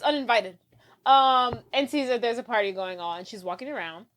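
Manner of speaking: ordinary talk, nothing marked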